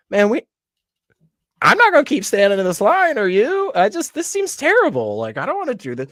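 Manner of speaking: squeaky put-on voice of a fed-up guy in line; high mock bystander voice. Imitating a fed-up person stuck in a long line, high and fast.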